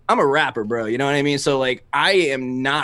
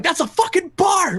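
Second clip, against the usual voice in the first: much higher hyped-up yell; retold hype yell; 3 dB louder than usual. Retelling screaming his verse in the car, hyped yell. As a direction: shout it like you're hyped in the car. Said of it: "I was just screaming it in the car".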